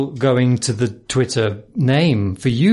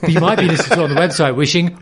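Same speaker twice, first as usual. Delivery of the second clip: usual speaking voice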